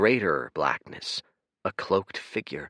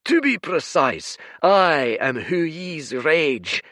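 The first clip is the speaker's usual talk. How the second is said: booming creature; 8 semitones higher, 8 dB louder than usual. Acted. Red giant bellowing at the rowing stranger, furious roar.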